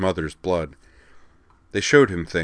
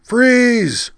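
much higher, loud barked order; barked command; 10 dB louder than usual. Acted. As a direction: stop them dead with one shout. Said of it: "barked a voice from behind them".

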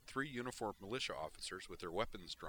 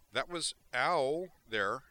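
slow, stretched-out dazed complaint; 6 semitones higher, 10 dB louder, much slower than usual. Acted. Griffin dazed on the floor, slow complaint. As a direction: talk like you're seeing stars.

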